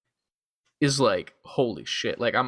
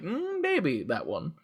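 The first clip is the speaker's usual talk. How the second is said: coy teacher; 2 semitones higher, 6 dB quieter, slower than usual. Pretend. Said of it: "And they're like".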